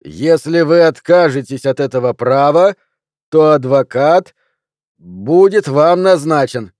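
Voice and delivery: loud, slow official warning; deliberate recital. A narrator's reading. Policeman finishing the rights to suspect, loud and slow. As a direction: spell it out slowly and loudly.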